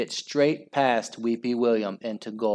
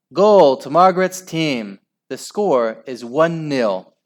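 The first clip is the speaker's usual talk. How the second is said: referee's announcement; 3 semitones higher, 6 dB louder than usual. A narrator's reading. Referee announcing a goal, calling it out. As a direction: announce it to the whole pitch.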